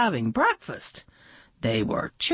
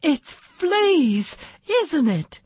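gasping realization; 10 semitones higher than usual. Acted. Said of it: "Miss Garbark gasped".

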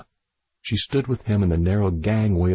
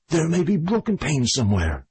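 high-pitched shout; 6 semitones higher than usual. A narrator's reading. Doctor warns the girl in the greenhouse, shouting high. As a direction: call the order out high and desperate. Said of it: "shouted at the highest pitch of his voice".

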